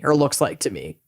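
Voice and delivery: ordinary talk, nothing marked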